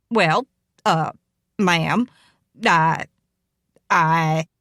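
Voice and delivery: flustered stammer; stuttering. A narrator's reading. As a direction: trip over the words, embarrassed. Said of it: "the sheriff stuttered into silence".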